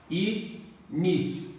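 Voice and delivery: "Início" stretched out with a pause inside; syllable by syllable. Shown as a demonstration. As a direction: break "Início" slowly into its syllables. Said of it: "Faça a separação silábica". ("Início" pausado, separando as sílabas)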